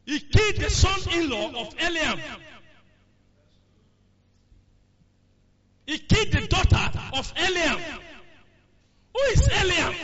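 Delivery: rising preaching. Acted. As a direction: build the sermon to a peak. Preacher building to a climax.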